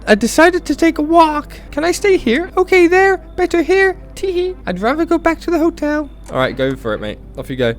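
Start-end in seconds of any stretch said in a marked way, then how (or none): 0.1-6.1 very high falsetto, cutesy; high-pitched put-on girl voice; nervous girl visitor. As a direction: squeak it out in a giggly falsetto. Plays nervous female visitor.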